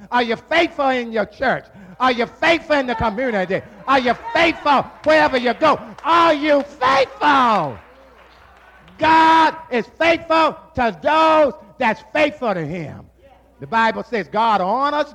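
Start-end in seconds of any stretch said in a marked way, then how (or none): 2.0-13.1 rising preached challenge. Acted. Preacher challenges the congregation, building preached shout. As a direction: press the question on them.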